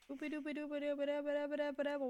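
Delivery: fast singsong rewind noise. Pretend. Going back in time with a silly rewind singsong. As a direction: patter it like a tape rewinding.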